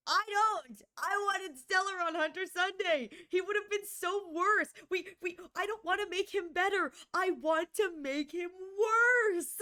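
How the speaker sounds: high, loud, worked-up rant; heated excitement. Real, not acted. Ranting to chat about the character. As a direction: push it out passionately.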